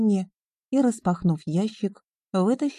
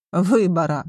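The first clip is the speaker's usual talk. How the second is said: ordinary delivery, nothing marked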